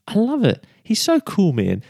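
high, bright delight. Real, not acted. Preacher delighted by Jesus, high excited praise. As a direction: gush with delight.

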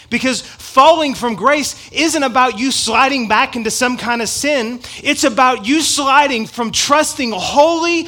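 loud, driving sermon voice; fiery preaching. Real, not acted. Pastor preaching hard to his church, loud and driving.

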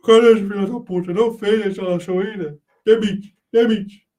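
loud, bossy imitation of the old chief; imitating the boss. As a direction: bark orders like an angry boss. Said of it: "Ô, Paulão, falava assim".

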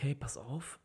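ordinary talk

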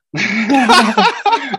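loud high laugh bursting out; loud real laughter. Real, not acted. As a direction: crack up at the memory.

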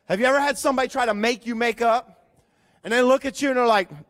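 ordinary delivery

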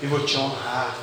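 soft low voice of God, close; right in your ear. Pretend. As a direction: murmur it gently into the ear. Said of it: "Bem suave. aquela voz gostosa".

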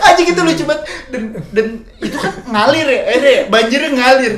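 cracked-up storytelling; laughing while talking. Real, not acted. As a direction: struggle to tell it through real laughter. Teller cracks up at own story. (bercerita sambil ketawa ngakak)

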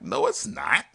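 high, firm no; man refusing to believe she's his date; flat denial. Retold or imitated. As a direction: shut it down, not buying it. Denying her identity.

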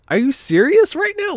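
high disbelieving cry; incredulous. Retold or imitated. Retelling disbelief at the clerk, high and incredulous. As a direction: shoot way up in disbelief.

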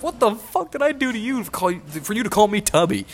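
high-pitched mock outrage; indignant retort. Friend answers an actor who insulted him, high mock outrage. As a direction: imitate an affronted friend, voice shooting up.

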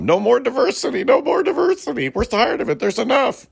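high, whiny mock complaint; mocking radio bosses. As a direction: whine it like a fed-up exec. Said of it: "that's what iHeartRadio said".

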